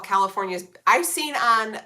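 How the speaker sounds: ordinary talk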